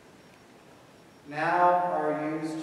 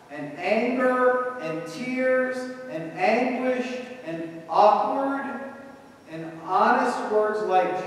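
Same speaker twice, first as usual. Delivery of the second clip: slow, lifted, louder list; building preaching; 6 semitones higher, 5 dB louder, slower than usual. Acted. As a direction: stretch each word as it builds.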